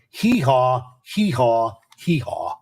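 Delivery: low mock donkey hee-haw, fading out at the end; donkey bray. Host mocks the Democrats with a low braying donkey. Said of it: "there's a donkey going".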